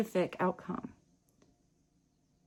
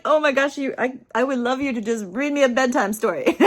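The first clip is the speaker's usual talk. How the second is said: loud, giggly, delighted plea; excited retold plea, bubbling with laughter; gushing request; 3 semitones higher, 8 dB louder, faster than usual. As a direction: gush as if starstruck by the voice.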